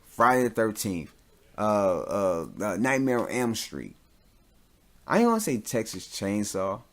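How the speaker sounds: ordinary talk, nothing marked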